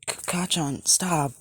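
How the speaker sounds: high, stammering plea for mercy; frightened pleading. Acted. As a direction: beg him to stop, terrified. Izuku pleading in his nightmare.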